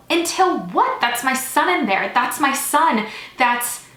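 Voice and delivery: loud, high, swooping protest; desperate shouting. A narrator's reading. Father shouts at the nurse to see his son, desperate shouting. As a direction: shout it like a father being held back. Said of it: "and more shouts as security guards drag my father".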